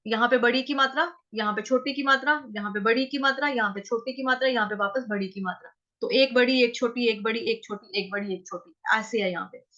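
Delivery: usual speaking voice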